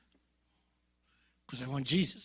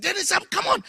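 protesting classmate; 8 dB louder than usual. Retold or imitated.

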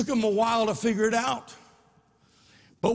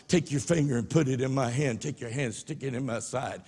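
low, soft and tender; gentle Jesus; 7 semitones lower, 4 dB quieter than usual. Acted. Jesus speaking softly to doubting Thomas, tender. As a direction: invite him gently, quietly.

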